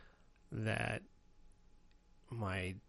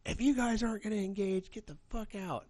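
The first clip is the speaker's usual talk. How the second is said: much higher, mock-angry teacher; teacher losing it; 12 semitones higher, 3 dB louder than usual. Teacher ordering the class out, imitated angry outburst. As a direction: snap like the fed-up teacher. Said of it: "finally just lost her shit".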